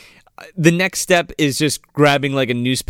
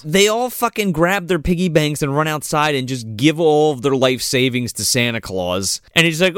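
usual speaking voice

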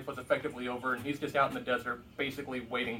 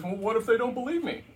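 raised, louder worried plea; anxious Moses; 8 semitones higher, 6 dB louder than usual. Retold or imitated. Moses pleading with God, anxious and higher. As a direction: push back nervously, voice up high. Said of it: "Moses' next question is".

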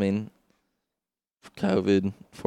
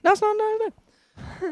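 high squeal. Pretend. Contradicts quote.

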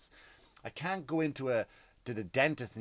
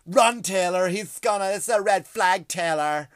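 high, loud mock woman shrieking a warning; mock woman's voice; 6 semitones higher, 10 dB louder, slower than usual. Comic mimicking women fans warning [name], high loud mock voice. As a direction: play a panicking fangirl, much louder. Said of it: "This is a woman's voice".

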